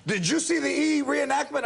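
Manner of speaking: high, indignant mock question; imitated upset wife. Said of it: "like, upset".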